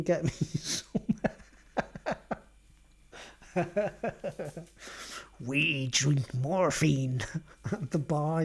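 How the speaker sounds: slow, laughing put-on voice; laughing mock of the goth girl. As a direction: lightly mimic her ordering morphine. Mimicking the goth girl at a bar.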